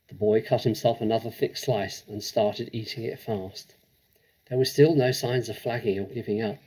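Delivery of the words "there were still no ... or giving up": plain reading voice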